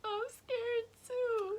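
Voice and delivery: mock scared whimper. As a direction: play it like a kid dreading mom. Retold fear of clean-freak mom.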